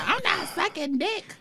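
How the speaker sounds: high-pitched mock insistence; emphatic self-quote. Quotes her own former firm stance.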